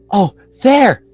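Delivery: hushed excited cry; breathless boy's exclamation. A narrator's reading. Boy crying out at window.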